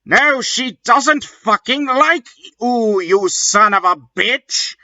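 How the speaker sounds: high hammed-up insult; mock yell. Draco yells at Vampire, high and mock angry. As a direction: hurl it, voice up high. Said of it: "yelled Draco".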